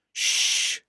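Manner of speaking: a breathy shh; a gentle engine hushing his rude friend. A narrator's reading. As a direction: hush him quickly.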